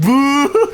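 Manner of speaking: long, high imitated boo; crowd boo. Streamer retells the crowd's reaction to the belt, imitated boo. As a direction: boo like a whole arena, imitated. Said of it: "Everyone's just like".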